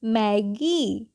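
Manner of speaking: Maggi stretched slowly, the ee held long; teacher drawing out Maggi; very slow demo. Shown as a demonstration. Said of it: "क्या हो जाएगी".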